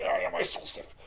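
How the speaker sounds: breathy, tight self-mockery; strained whisper. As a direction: squeeze it out like stiff shoulders ache. Mimics himself being stiff.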